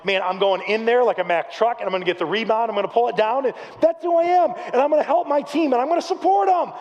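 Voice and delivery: retold self-hype, ending high and emphatic; psyching himself up. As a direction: build from determined to all fired up. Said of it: "it psyched myself up".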